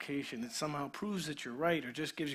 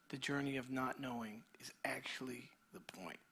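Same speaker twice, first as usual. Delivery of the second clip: much softer, whispered question; hushed whisper; 5 semitones lower, 10 dB quieter than usual. Real, not acted. Pastor drawing the congregation close, whispering. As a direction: lean in and whisper it.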